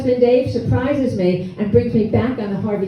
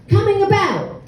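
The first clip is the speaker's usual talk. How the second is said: high called-out sailing command; 9 semitones higher than usual. Retold or imitated. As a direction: call it down below like a deckhand. Sailor yelling a command below.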